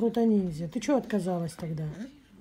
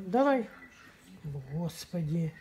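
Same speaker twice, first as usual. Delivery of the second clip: ordinary talk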